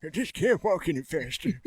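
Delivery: lightly hammed-up comic protest; put-on feeble character. Joins the yard-owner skit.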